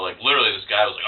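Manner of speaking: normal talking voice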